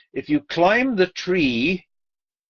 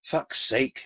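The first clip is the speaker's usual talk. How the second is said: usual speaking voice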